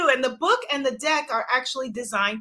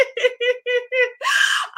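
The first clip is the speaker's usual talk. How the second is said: real hearty laugh; 13 semitones higher than usual. Real, not acted. Host reacting to a viewer's joke, laughing hard. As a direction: crack up at a funny comment. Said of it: "I am laughing so hard".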